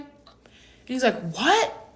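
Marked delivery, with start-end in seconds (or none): 1.3-1.9 high-pitched, rising mock disbelief; incredulous. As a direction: act taken aback at being forgotten. Acts his disbelief at being forgotten.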